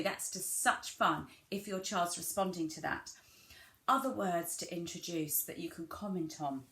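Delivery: usual speaking voice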